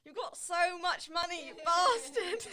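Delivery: loud laughing mock insult; laughing at him for having so much money. Real, not acted. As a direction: shout it while cracking up.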